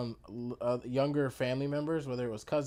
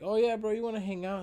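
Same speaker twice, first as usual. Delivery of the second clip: higher, louder mock-friend voice; friend's casual invite; 9 semitones higher, 5 dB louder than usual. Friend asking bro to hang out, raised retold voice. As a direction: toss out an eager invite to a buddy.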